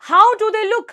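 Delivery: high, loud storybook question; eager asking. Acted. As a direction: pitch it up, keen for an answer. Story woman asks about her cookies.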